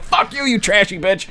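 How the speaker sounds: high yelled insult; mock yell. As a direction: yell back at the woman. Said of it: "I'm like".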